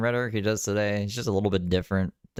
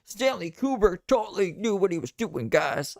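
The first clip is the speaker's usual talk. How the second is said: mocking; 11 semitones higher than usual. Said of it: "and then just being like".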